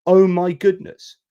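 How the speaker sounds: high, slow, drawn-out oh my goodness; dismayed exclamation. Retold or imitated. Imagining the European reaction, slow dismayed exclamation. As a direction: let the dismay stretch out slowly. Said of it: "And you're thinking".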